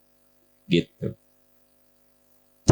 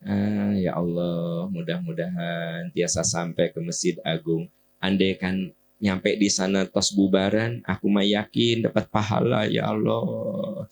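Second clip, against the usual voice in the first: accepting sigh; 4 semitones lower than usual. Acted. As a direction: sigh it out gently, with patient hope. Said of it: "Tapi kalau gini".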